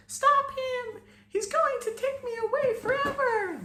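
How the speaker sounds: very high, tiny, frightened pleading; a tiny fairy begging for rescue; trapped fairy crying out in fear; tinny scared voice. A narrator's reading. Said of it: "the tinny voice said".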